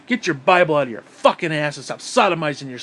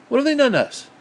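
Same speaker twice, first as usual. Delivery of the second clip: high, louder demanding question; exasperated question; 9 semitones higher, 5 dB louder, faster than usual. Real, not acted. Ranting about Iran, demands a high, exasperated answer.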